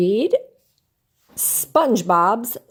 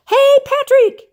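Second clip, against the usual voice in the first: much higher, louder, slow calling out; called out; 7 dB louder, slower than usual. Acted. SpongeBob arriving at Patrick's house, calling out. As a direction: shout it across to his friend's house. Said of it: "he called".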